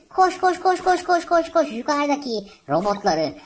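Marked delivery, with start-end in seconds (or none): 0.1-1.7 high, rapid cheering of koş; egging the brawler on, pitch up; excited urging. Real, not acted. Urging his brawler up the map, excitedly cheering it on.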